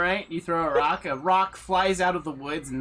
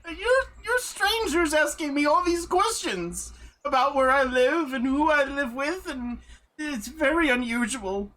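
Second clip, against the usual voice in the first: put-on higher, fretful old woman; 6 semitones higher than usual. Old woman tells the strangers to leave her alone. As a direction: fret and ask them to leave.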